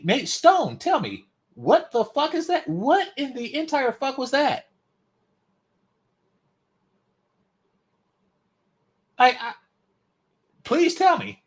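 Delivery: high, loud exasperated ranting. Real, not acted. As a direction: demand an answer, fed up. Ranting at chat about humanities.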